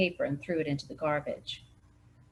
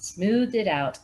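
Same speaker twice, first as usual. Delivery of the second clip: usual speaking voice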